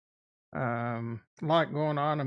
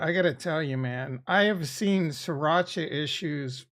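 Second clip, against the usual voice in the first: ordinary talk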